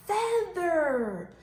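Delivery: FEATHER drawn out in two long syllables, falling; long, clear Feather gliding down; word modelled. Shown as a demonstration. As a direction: say Feather slowly for children to copy. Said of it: "Let's say that together".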